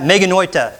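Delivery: Meganoita, repeated as a model for the class; Meganoita, modelled for repetition; Greek meganoita. Shown as a demonstration. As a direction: say Meganoita again for them to copy. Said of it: "Can you say that?".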